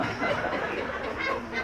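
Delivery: breathy real laugh; unvoiced laughing burst; laughter. Real, not acted. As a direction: let the laugh spill out. Laughing at his own story.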